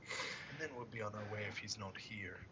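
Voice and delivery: quiet, measured questioning voice; soft-spoken pursuer. Acted. Boarding man asking after a winged devil, soft and controlled. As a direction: speak softly, as a searcher questioning a captain.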